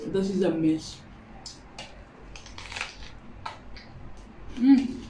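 talking while biting pepper; muffled crunchy chewing speech; mouth full, chewing. Real, not acted. Seen 1: Bites and chews a whole green pepper, not a sandwich.

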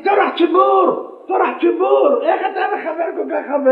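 mock rebuke shouted from the pulpit; scolding shout. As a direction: yell it at him, impatient. Rabbi scolding the shofar blower.

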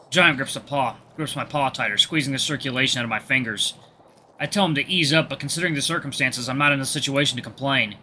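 usual speaking voice

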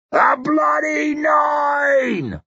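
a very slow, high roar; drawn-out triumphant roar. Acted. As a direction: roar it to the sky on your knees. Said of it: "roared Crummock".

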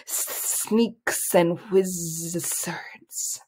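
very slow, hissing snake whisper; soft whisper. A narrator's reading. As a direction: whisper with long sibilant hisses. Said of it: "whispered the soft voice".

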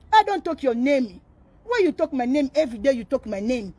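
usual speaking voice

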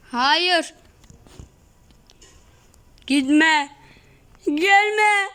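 high, frantic, near-tearful begging; panicked pleading. Real, not acted. Gamer begging the chasing dinosaur, panicked and near tears. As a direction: beg it not to come, voice cracking high.

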